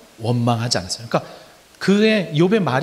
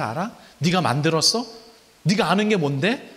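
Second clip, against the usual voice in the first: higher, forceful rhetorical questioning; God challenging Job; 3 semitones higher than usual. Acted. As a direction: confront him from above, daring him to answer. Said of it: "라고 하나님이 욥에게 묻는 거예요".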